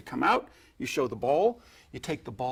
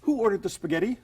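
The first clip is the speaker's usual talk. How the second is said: mock waiter; 8 semitones higher, 4 dB louder than usual. Shown as a demonstration. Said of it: "you say".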